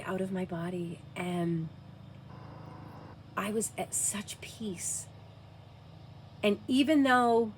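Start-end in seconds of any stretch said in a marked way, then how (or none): 3.3-5.1 whispered, soft and slow; hushed whisper. Real, not acted. Mother recalling near-death, whispering softly. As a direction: breathe the words as if at peace. Describing peaceful out-of-body state.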